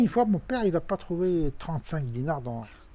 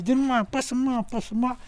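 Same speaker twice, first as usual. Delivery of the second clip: put-on high whiny child's voice, much higher; pestering kid; 11 semitones higher than usual. Father voicing his child self nagging his sister, high and whiny. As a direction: nag like a little brother begging.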